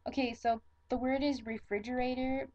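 usual speaking voice

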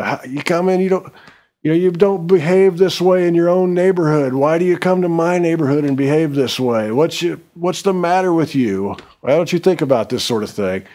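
put-on yelling at frat boys. The narrator yelling at frat boys in his neighborhood, angry scolding. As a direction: tell them off like they're trashing your street. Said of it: "I'm yelling like this".

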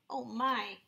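plain reading voice

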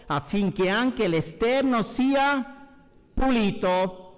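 normal talking voice

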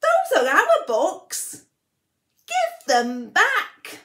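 loud, slow, high yell; children yelling. Acted. Children confronting the book thief, loud slow yell. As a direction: demand it back, loud and slow. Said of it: "and the children yelled".

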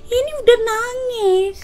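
slow, high-pitched whine; teasing whine. Real, not acted. Telling the partner they are already crying, drawn-out teasing whine. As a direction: drag it out high, as if tearful.

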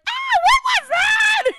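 loud, drawn-out high shriek; imitating panicked son. Mom mimicking son who just sprayed mace, freaking out. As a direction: shriek it like a startled kid, way up high. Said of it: "He's freaking out".

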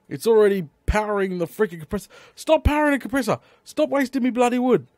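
high, loud, rapid outburst; exasperated scolding. Real, not acted. Gamer telling off the compressor for wasting wood, exasperated. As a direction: scold the machine in mounting exasperation.

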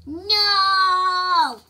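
long loud high cry of no; anguished no. Acted. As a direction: wail it as friends turn to dust. Character cries as people fade.

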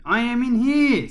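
high, loud call from hiding; shrieky, drawn-up shout; hidden voice calling. A narrator's reading. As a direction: call out so searchers can find you. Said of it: "said the voice".

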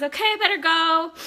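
high, loud quoted sign-off; friend's breezy goodbye. Retold or imitated. Friend tells her she has to go, called out high. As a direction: imitate a friend calling out she's leaving.

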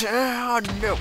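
high, loud cry of alarm; startled yell. Real, not acted. Gamer caught by a sudden threat, alarmed yell. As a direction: yell out as danger jumps at you.

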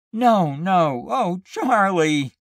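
high, loud anguished protest; about to burst into tears. A narrator's reading. Wife to grieving husband, nearly in tears. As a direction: wail it on the edge of weeping. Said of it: "burst into tears herself".

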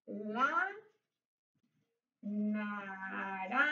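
low, soft, stretched syllables in NARANJA; drawn-out dictation. Shown as a demonstration. Teacher dictating the fruit word as she writes, drawn out. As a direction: sound out naranja very slowly. Sounds out word while writing it.

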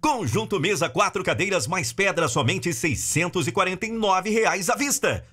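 high, punchy hard-sell retail announcer; retail ad read. Shown as a demonstration. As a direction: sell the deals like a TV store ad. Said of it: "Essa locução em específico é nesse estilo".

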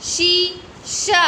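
शीशा with both long ई and आ drawn out; teacher stretching शीशा for the class; stretched reading. Shown as a demonstration. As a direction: pull out शीशा slowly, vowel by vowel. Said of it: "क्या हुआ ये?".

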